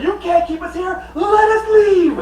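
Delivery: high, loud wailing plea; mock crying plea. Prisoner wailing at the king to let them leave, high and loud. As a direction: beg to be let out, hammed up. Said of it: "Charles like".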